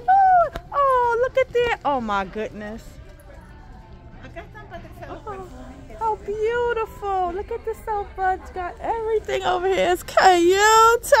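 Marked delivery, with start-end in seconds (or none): 0.1-1.8 high, loud squeal; excited shriek. Real, not acted. Shopper spotting more decor, high loud shriek. 5.1-11.1 gushing delight. Real, not acted. Shopper admiring a decorated sofa, high gushing squeal.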